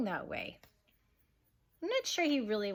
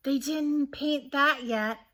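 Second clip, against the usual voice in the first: put-on slow, louder child's shout; 5 semitones higher, 7 dB louder, slower than usual. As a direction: call it out loud and slow. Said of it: "Peter shouted".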